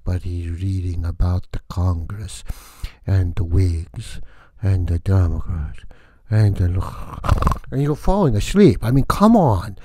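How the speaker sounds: loud, huffy vampire rant; vampire count voice. Acted. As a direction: complain grandly as the vampire. Count rants at Grant Miller.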